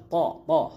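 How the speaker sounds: "طا، طا" thick, from the back of the mouth; back-of-mouth sound. Shown as a demonstration. Teacher drilling the letter for learners, heavy "طا، طا". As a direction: repeat "طا، طا" as a heavy T syllable. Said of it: "منہ کی back side والی آواز".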